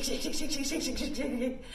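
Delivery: ordinary talk, nothing marked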